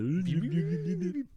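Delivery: high vocal rewind noise; tape rewinding. Pretend. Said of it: "gotta do the fucking rewind noise".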